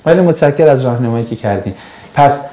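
ordinary talk, nothing marked

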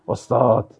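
a hint of an overly careful thin voice; teasing imitation of a student calling out; affected student voice.

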